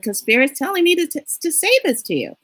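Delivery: quick, high-voiced answer; high-pitched retort. Reader answering an amazed client, quick and high-pitched. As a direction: hurry it out high, as if explaining the obvious.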